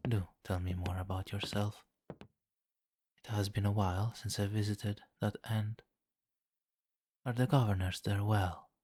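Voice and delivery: ordinary delivery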